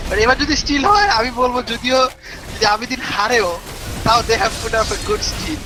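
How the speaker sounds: hyped-up caster call; esports caster. Acted. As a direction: raise the stakes like a live caster. Caster hypes possible Baron steal. (উৎসাহী ধারাভাষ্যকার)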